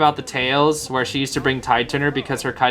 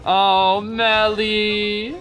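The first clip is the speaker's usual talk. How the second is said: high, drawn-out lament rising higher; despairing wail; 7 semitones higher, much slower than usual. Real, not acted. Caster lamenting Melly's defeat, high despairing wail. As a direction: wail the name in despair, stretched out.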